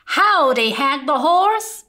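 put-on Cowboy Baby greeting his toy bear, eager. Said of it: "said Cowboy Baby".

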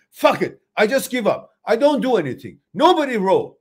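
sulky sailor refusing to row, mock fed-up; defeated crew giving up. A sailor refusing to row in a storm, fed-up and loud.

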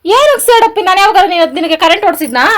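loud, high-pitched accusing question; indignant outcry. Real, not acted. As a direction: confront your husband at full volume. Wife asks why he takes revenge.

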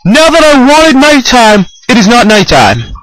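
loud exasperated yell; frustrated shout. Real, not acted. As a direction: shout in disbelief. Frustrated outburst.

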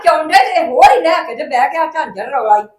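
loud, heated shouting at the family; furious family row shout; angry shout. Acted. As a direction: shout it out in anger. Family quarrel, raised voices.

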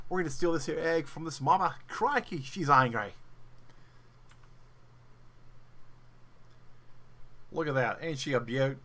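mock Australian wildlife presenter, over the top; Steve Irwin voice. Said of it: "Cue the Steve Irwin voice".

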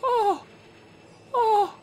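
high startled oh after oh; real panicked little cries; frightened yelps. Real, not acted. Repeated frightened yelps after scare.

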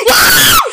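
shrill scream breaking into a cough; piercing scream. Real, not acted. Gamer screaming at the jump scare, then coughing. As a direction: scream so hard the voice cracks. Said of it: "easy on the voice".

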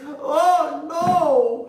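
high, loud cry of despair. Acted. Farmer losing his brown eggs, crying out high. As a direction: wail it as the eggs break. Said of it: "he despaired".

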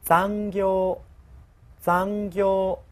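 残業 read with a level zero-accent tone; flat zero tone. Shown as a demonstration. Teacher modelling the zero-tone 残業 for the class. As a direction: say 残業 flat, no drop at the end. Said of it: "零调".

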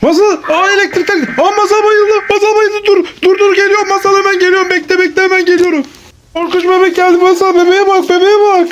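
high, urgent mock-scared cries; play panic. Parent warns child the scary baby has come, play panic. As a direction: yelp in pretend alarm as the lights fail.

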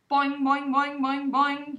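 high, loud frog boings; boing like a worried frog. A narrator's reading. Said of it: "he replied".

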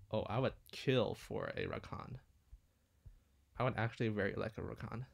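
normal talking voice

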